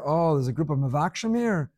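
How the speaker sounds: ordinary delivery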